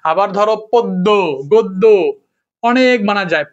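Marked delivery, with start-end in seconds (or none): 0.7-1.8 পদ্য, গদ্য as "pod-do, god-do"; doubled d. Shown as a demonstration. Teacher listing examples, পদ্য and গদ্য with doubled d. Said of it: "দ্বিত্ব উচ্চারণ হবে".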